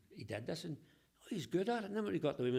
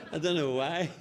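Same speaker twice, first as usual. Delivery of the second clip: words spilling out mid laugh, louder; laughing through words; 4 semitones higher, 9 dB louder than usual. Real, not acted. Storyteller laughing at his own jokes while talking. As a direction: still laughing as he says it. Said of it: "I laugh hard better at me".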